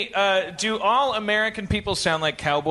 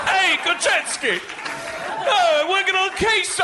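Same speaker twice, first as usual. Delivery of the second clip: put-on botched New York accent sounding British; bad New York accent; 7 semitones higher than usual. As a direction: parody the New Yorker badly. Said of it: "most British accent I've ever heard".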